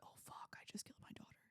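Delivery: whispered, hushed dread; whispered horror. Retold or imitated. A general whispering after downing his daughter's helicopter. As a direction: breathe it out in shock. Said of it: "And Ross is like".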